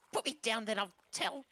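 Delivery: high little bargaining voice; pleading captive kid. Acted. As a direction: plead high like a caught child.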